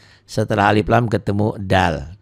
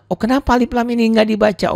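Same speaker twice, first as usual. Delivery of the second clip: playful high voice; 11 semitones higher, faster than usual. Acted. Acting out a pupil's question.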